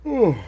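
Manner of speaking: big high yawn. Real, not acted. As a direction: let out a long, tired yawn. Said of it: "there's the first yawn".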